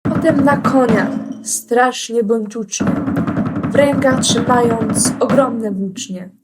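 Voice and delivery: usual speaking voice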